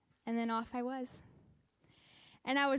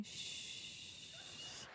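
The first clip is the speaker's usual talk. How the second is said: retold shush; 16 dB quieter than usual.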